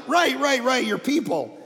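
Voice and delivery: laughing sheepishly through it; embarrassed laugh. Retold or imitated. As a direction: caught out, chuckle while fumbling the name. Caught out, embarrassed.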